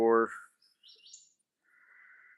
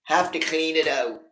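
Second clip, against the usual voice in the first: light, slightly higher cat voice; cat talking back; 4 semitones higher than usual. Pretend. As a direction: voice the cat as a bit sassy, lightly done.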